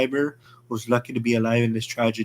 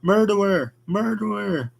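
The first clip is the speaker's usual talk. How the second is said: crowd yelling; 8 semitones higher than usual. Acted. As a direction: hurl the word at him.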